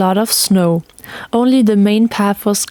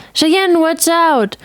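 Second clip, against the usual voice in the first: put-on boy's warning; 8 semitones higher, much slower than usual. A narrator's reading. Boy warns.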